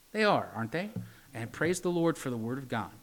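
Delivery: usual speaking voice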